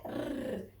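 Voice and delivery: "r" as a rolled trill; letter sound. Shown as a demonstration. Teacher gives children the R sound, trilled. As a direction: roll "r" alone. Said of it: "y la R".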